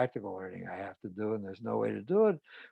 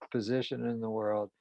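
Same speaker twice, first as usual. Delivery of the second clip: ordinary delivery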